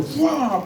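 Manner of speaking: high laughing whoosh; a preacher's playful swoosh of his mother's sandal; whoosh sound effect. Retold or imitated. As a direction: mimic the sandal flying through the air. Imitating the flying sandal.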